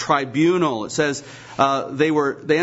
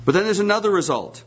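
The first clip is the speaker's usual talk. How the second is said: ordinary talk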